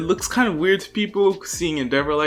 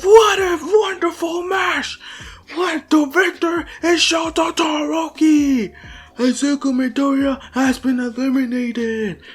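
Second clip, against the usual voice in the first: excited match announcer; 12 semitones higher than usual. Retold or imitated. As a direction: proclaim the winner high, like a ring announcer. Announcer proclaims match result.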